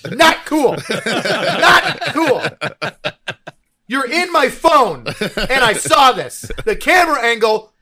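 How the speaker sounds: high, loud comic rant; angry movie review. Retold or imitated. Reviewer scolding a friend over his nude scene, exasperated yelling. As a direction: hammer each grievance with pained, mock-furious emphasis. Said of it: "I did an angry movie review".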